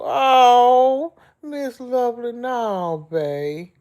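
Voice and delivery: high, loud wail easing off; tearful high cry, falling back to a normal pitch; real crying. Real, not acted. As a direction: burst out tearfully, then settle. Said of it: "you got me crying too".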